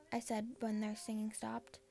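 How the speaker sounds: ordinary delivery, nothing marked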